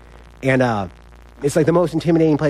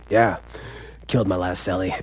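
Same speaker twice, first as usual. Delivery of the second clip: low, quiet deadpan; understated low threat; menacing cellmate; 6 semitones lower, 5 dB quieter than usual. Retold or imitated. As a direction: imitate a scary old convict, dropping the voice. Imitates menacing new cellmate's deadpan reply.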